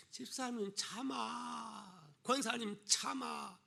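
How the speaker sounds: soft, very slow, drawn-out plea; mock soothing. Bystander urging church elders to hold back, mock soothing. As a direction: stretch out the calming words, acting a bystander.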